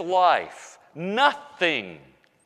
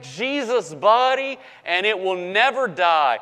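preaching; 4 semitones higher than usual. Acted. Pastor promising the congregation a new body, preaching.

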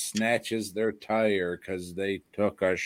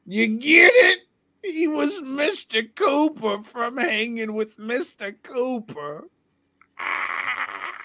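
mock wailing; 12 semitones higher than usual. Mock wailing over a pun.

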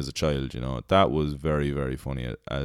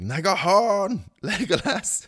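high, slow garbled Aragorn call; mock Aragorn; 3 dB louder, slower than usual. Aragorn directing Legolas, mock high garbled call. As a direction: mangle the name in a silly high voice. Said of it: "He's like".

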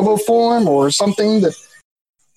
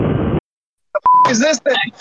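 startled; 4 semitones higher, slower than usual. Real, not acted. Startled by a scary horsefly.

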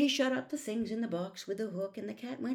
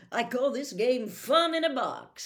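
showman cat; 3 semitones higher, 7 dB louder than usual. Acted.

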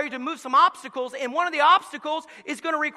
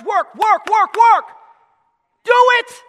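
from firm to loud, high cry; building shout; 9 semitones higher, 13 dB louder, slower than usual. Acted. Preacher rousing the congregation, building to a shout. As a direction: drive the word work home, building.

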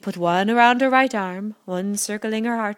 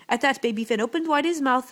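usual speaking voice

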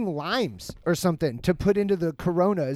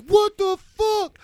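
high, drawn-out mock scream; imitated screaming; 12 semitones higher, 3 dB louder, much slower than usual. Guy screaming at brawling guests, imitated high yell. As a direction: stretch it out high like a screaming host. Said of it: "there's a guy screaming like".

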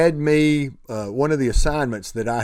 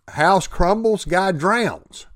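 usual speaking voice

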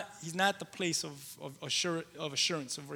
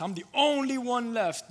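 despairing Elijah; 8 semitones higher, 7 dB louder than usual. Acted.

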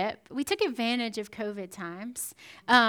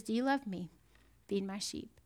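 gentle question; 5 semitones lower, 10 dB quieter than usual. Acted. Jesus gently recommissions Peter.

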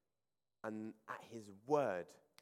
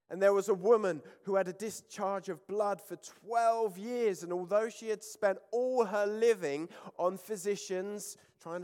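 raised, animated Bible reading voice; scripture reading; 6 semitones higher, 5 dB louder than usual. Acted. Preacher reading scripture aloud to the church, with fervour. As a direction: read the Gospel story with force to the congregation.

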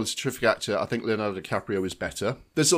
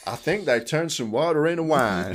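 raised, louder hammed-up Deep South voice; Deep South accent; 4 semitones higher, 5 dB louder than usual. Co-host joining the bit as Deep South folk, mock Southern accent. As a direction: play a Deep South local passing on news. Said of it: "it was folk from the Deep South".

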